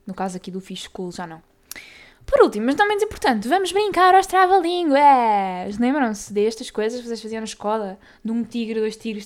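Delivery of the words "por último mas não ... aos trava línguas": high, loud and drawn-out game announcement; slow, loud, high "let's play" call; excited announcement. Real, not acted. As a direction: sell the big finale like a show host. Announcing the tongue-twister game.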